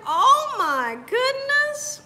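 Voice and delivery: high, drawn-out exclamation; shocked. Real, not acted. Woman reacting to Wish well's comment, shocked. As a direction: stretch it out high in disbelief.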